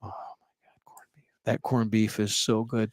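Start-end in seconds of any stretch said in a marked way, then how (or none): 0.0-1.2 whisper. Real, not acted. Craving the corned beef.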